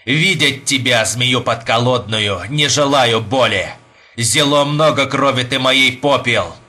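solemn, emphatic renunciation in archaic style; defiant young Russian, declaiming. Acted. Young man casts off his American tempter, defiant declamation. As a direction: declaim it firmly, stretching key words.

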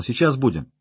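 ordinary delivery, nothing marked

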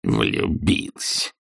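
low, very slow, soft sneer; scornful grumbling. A narrator's reading. Scrooge echoing his nephew's reason, scornful grumble. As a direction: grumble it slowly as utter absurdity. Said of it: "проворчал Скрудж таким тоном".

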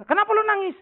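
high, loud mock-angry question; retold snapping. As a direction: bark it at a crying girlfriend, hammed up. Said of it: "Lu ngomong suka bentak-bentak gua". (meniru bentakan marah dengan nada tinggi)

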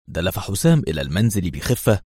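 ordinary delivery, nothing marked

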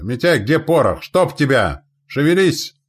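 loud, high, climbing impatience; exasperated commander's shouting; barked scolding. A narrator's reading. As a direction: yell at the slow powder boy. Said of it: "рявкнул командир расчёта".

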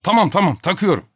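high, exasperated surrender; hasty giving in. A narrator's reading. Topak agreeing to wear the napkin, hastily giving in. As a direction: concede to save your dish. Topak gives in under threat.

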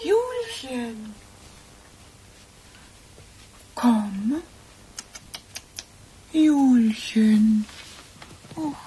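quiet, slow grief over the dead cat; stunned grief. Acted. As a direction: let it sink in, barely voicing it.

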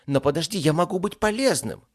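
high, loud, eager interjection; hurried pleading. Acted. Scared demon offers himself as useful, hurried. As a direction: jump in anxiously to save your skin. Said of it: "заторопился Кебаб".

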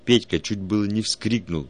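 ordinary delivery

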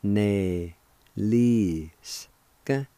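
ne, li, ska set far apart; long gap after each syllable of neliska; syllable-by-syllable pronunciation. Shown as a demonstration. Teacher sounding out neliska, slow separate syllables. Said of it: "it's pronounced like this".

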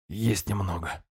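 dull hoarse croak, softly; hoarse rasp. A narrator's reading. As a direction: croak it out weakly. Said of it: "превратился в глухой хрип".

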